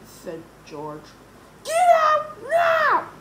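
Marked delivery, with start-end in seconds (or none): 1.6-3.1 high, loud, drawn-out order; angry shout. A narrator's reading. Yesterday George ordering the boys out, shouting angrily. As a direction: shout them out of the room. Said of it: "shouted Yesterday George angrily".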